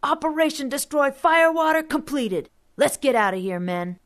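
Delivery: high, loud mock military order; kid commander shouting. A narrator's reading. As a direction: bark it like a boy playing platoon leader. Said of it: "Rusty whooped".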